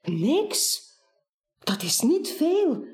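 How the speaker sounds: a touch of puzzled stammering, rising; mildly taken aback, questioning; stammered surprise. Acted. Buur, surprised by Baas's answer, stammers back with a mild rise. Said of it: "stamelt Buur verbaasd".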